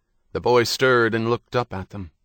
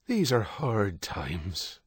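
weary, slow and soft; a tired priest sighing softly; mild sigh; 4 dB quieter, much slower than usual. A narrator's reading. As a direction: let the words sag with a sigh. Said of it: "Father Michael sighed".

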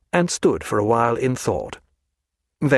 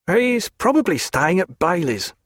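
guard guessing; 6 semitones higher than usual. A narrator's reading. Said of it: "said another".

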